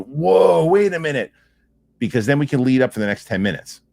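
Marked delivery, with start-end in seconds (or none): 0.0-1.3 a high, loud, hammed-up double take; mock surprise. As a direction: act the fan suddenly catching on. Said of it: "and, and people go".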